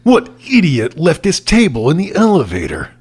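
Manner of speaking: angry villain. Acted. Boucher barking about the table left in the elevator. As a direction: bark at the idiot's table.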